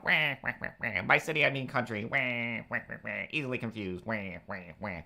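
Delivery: hammed-up Penguin squawk with wah noises; Penguin wah impression. Streamer mocks a politician as the Penguin, hammed-up whining. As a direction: mimic the Penguin's whiny speech as a joke. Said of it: "But I was all like".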